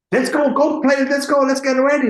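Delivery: high, loud imitation of a hyped-up star; rally the room, hammed-up; mock hurrying everyone along. Acting out Bobby hurrying everyone along.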